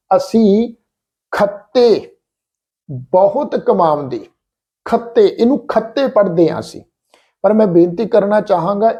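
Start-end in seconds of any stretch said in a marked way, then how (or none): 0.1-6.8 ਖੱਤੇ with a doubled hard ਤ and plain ਖ; wrong pronunciation demo. Shown as a demonstration. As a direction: stress the doubled ਤ in ਖੱਤੇ as the common mistake. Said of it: "ਅਸੀਂ ਇਸ ਢੰਗ ਨਾਲ ਉਚਾਰਨ ਕਰਦੇ ਹਾਂ". (ਖੱਤੇ ਗਲਤ ਉਚਾਰਨ, ਦੁੱਤ ਵਾਲਾ ਤ)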